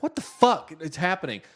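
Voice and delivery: loud, high mock disbelief; retold incredulous yelp; baffled outburst. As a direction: burst out in exaggerated bewilderment. Retelling his baffled inner reaction.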